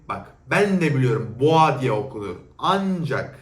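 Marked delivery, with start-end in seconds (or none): 0.1-2.4 defensive retort. Real, not acted. Retorting to the commenter.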